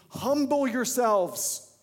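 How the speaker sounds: loud, slow, stretched-out call; preached command. Retold or imitated. Preacher urging the church: loud, slow call. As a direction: thunder the command to humble themselves. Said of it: "He's saying".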